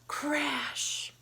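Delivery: breathy whispered crash. Acted. Storyteller whispering crash to children, hushed. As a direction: hush the word crash.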